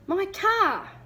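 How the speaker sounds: high, loud frantic shout; frantic cry. A narrator's reading. Father crying to the costumed monster, frantic and loud. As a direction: cry out in alarm. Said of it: "Dad cried frantically".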